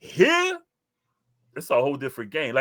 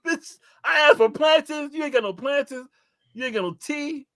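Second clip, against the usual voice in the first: retold angry rant; 14 semitones higher, 4 dB louder than usual. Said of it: "the lady was ready to fight me".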